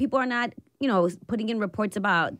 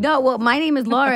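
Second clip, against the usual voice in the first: usual speaking voice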